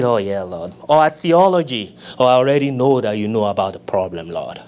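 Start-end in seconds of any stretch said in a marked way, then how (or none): 0.0-0.7 casual prayer. Retold or imitated. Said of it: "Jesus is not impressed at our personalities". 2.3-4.6 low, offhand, indifferent tone; mock a lazy prayer; bored casual prayer. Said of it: "Jesus is not impressed".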